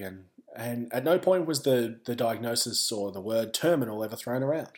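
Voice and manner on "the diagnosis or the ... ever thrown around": ordinary talk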